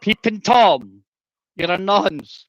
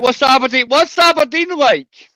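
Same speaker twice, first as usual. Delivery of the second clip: high-pitched mocking imitation; hammed-up teasing mimicry; mimicking opponent; 6 semitones higher than usual. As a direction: parrot the question way up high. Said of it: "You're like".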